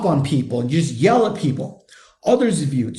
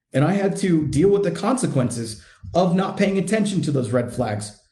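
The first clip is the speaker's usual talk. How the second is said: ordinary talk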